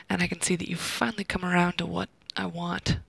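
usual speaking voice

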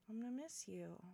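tender sad confession. Acted. Telling departing friend they will be missed, soft and sad. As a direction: let it out softly, with regret.

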